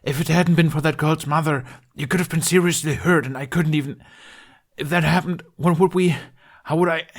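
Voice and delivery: Bunny's voice, high and loud, upset; worried scolding. Acted. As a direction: scold him out of fear for him.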